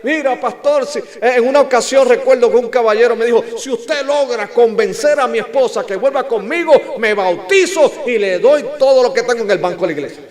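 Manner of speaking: high, loud retold plea; imitated urgent bargaining. Preacher imitates husband begging him for help, urgent bargaining. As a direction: press the pastor with a hammed-up offer.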